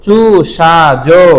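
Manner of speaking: "চুষাজো" very slowly, each syllable drawn out; slow drill pronunciation. Shown as a demonstration. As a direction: stretch চু, ষা and জো long for learners to repeat. Teacher models word for class repetition. ("চুষাজো" খুব ধীরে, টেনে টেনে)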